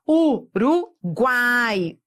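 Uruguai stretched very slowly into syllables. Shown as a demonstration. Teacher separates Uruguai for students, very slow. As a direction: break Uruguai apart slowly. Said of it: "teacher syllabifies word".

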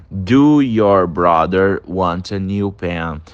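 slow, deliberate wrong form; wrong version. Shown as a demonstration. Teacher showing students the wrong form, slow and deliberate. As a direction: read the mistake out slowly. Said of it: "Não fica".

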